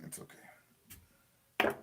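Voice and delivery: breathy whisper, slow, to himself; calm yourself down under your breath. Real, not acted. Streamer soothing himself after the frustration, whispered. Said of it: "Very frustrating".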